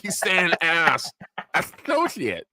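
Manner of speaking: slow, mocking; mock the lisp slowly; mocking kids. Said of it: "They're like".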